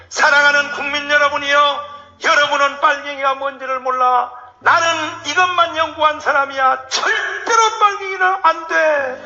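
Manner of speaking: rally the crowd against the Reds; rousing speech. Retold or imitated. Rhee's speech to the nation, acted.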